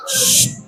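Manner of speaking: sharp teacher's "shh" to quiet the class; hushing shush. Real, not acted. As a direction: hush a restless room of pupils. (desisan menyuruh diam)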